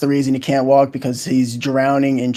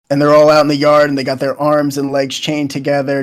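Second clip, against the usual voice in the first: ordinary talk, nothing marked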